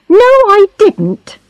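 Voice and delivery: high-pitched, heated denial; angry. A narrator's reading. Charlotte admits disliking Missus Blakemore, angry retort. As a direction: throw the answer back angrily. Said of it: "said Charlotte angrily".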